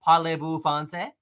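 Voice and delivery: a mock French accent, lightly put on. Shown as a demonstration. Streamer shows off the only French he knows, mock French accent. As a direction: say it like a proud tourist in a French accent. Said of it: "love the French accent".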